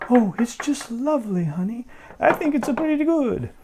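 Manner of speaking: high wife voice. Acted. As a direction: coo it like a doting wife. Wife answering Wotan.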